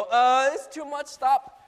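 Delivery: loud, high, slow wail to stop; hammed-up mid-ride protest; panicked plea. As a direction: beg loudly to be let off.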